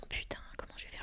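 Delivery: breathless whispered panic to herself; panicked whisper. Retold or imitated. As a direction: whisper it, alone and desperate. Panique seule au salon. (chuchotement paniqué)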